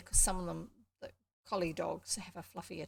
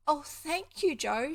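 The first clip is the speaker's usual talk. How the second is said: high, louder burst of gratitude; delighted exclamation; 7 semitones higher, 7 dB louder, slower than usual. A narrator's reading. Girl thanks the farm boy for the puppy, delighted exclamation. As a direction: exclaim it, overjoyed. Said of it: "she exclaimed, almost too pleased to speak".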